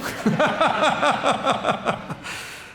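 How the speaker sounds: high, rapid chuckling; amused laugh. Real, not acted. Rabbi laughing at his own accent joke, amused. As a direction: laugh in a long run of quick pulses.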